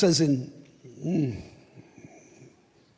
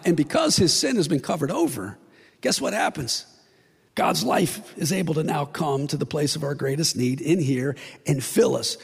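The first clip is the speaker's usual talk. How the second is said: ordinary delivery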